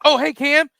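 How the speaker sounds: sudden fake-friendly hi; caught-off-guard greeting. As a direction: act like you just noticed him. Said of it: "He's like".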